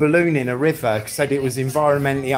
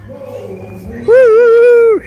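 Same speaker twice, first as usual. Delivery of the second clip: much higher, loud whoop; high, loud celebratory woo; 9 dB louder than usual. Real, not acted. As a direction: let out a big cheer. Cheering after jamming the mic.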